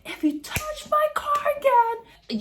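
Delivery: high, slow threat; repeating her threat to the woman. Retold or imitated. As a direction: warn her off. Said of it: "I was like".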